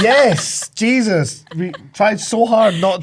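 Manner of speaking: laughing, half yelling yes; laughing exasperation. Retold or imitated. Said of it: "And I was like".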